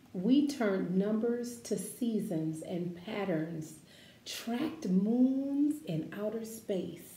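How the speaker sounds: slow, expressive picture-book read-aloud; reading to children. Acted. Teacher reading a picture book to her class, expressive read-aloud.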